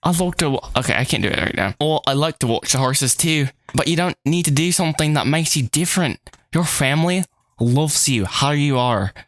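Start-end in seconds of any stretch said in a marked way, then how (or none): 0.0-0.7 higher-pitched British attempt; British accent. Jane starting to reassure Betty, British accent. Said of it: "doing this in a British accent". 1.8-9.1 acted British accent, slowing at the end. Jane comforting Betty about family, British accent. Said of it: "doing this in a British accent".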